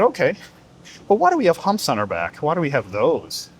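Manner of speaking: a small camel asking its mother why; curious baby camel. Acted. Baby camel asks mother about humps, curious child voice.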